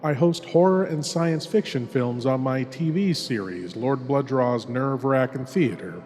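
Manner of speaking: usual speaking voice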